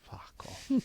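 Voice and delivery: whispered, barely voiced brush-off; muttered dismissal. Podcaster dismissing his own Brummie impression, whispered aside. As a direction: mutter it right under your breath.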